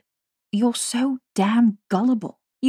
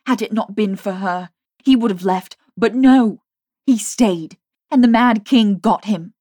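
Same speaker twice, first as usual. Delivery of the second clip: furious; 3 semitones higher, 7 dB louder than usual. A narrator's reading. Jamie's furious tirade.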